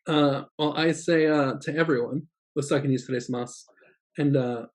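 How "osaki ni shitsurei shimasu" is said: "Osaki ni shitsurei shimasu" rattled off fast; quick everyday office goodbye; native-speed demo; fast natural take. Shown as a demonstration. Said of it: "natural then slow demo".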